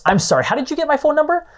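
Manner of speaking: a caller annoyed at a cold call; annoyed interruption. Acted. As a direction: snap it out like an irritated stranger on the phone. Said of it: "Kurt again interrupts".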